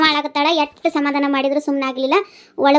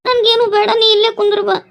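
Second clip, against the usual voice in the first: higher, tearful pleading; crying; 5 semitones higher than usual. Acted. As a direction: plead through tears for him to stay. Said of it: "ಯಾಕೆ ಅಳಾಕತ್ತಿ".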